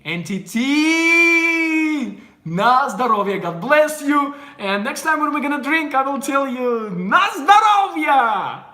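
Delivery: high, loud, drawn-out cheer; shouted toast. Real, not acted. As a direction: call out a rousing toast at full voice. Calling out a festive toast.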